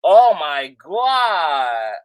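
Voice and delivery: slow, stretched-out gasp of disbelief; shocked. Real, not acted. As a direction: drag it out, stunned. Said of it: "I'm shook".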